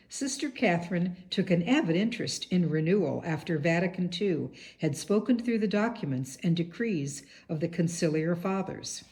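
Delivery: normal talking voice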